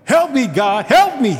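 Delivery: high, loud, desperate cry for help; a desperate cry to God, alone at night. Pretend. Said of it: "you cry out to God".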